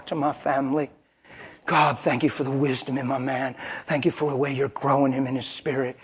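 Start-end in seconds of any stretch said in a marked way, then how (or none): none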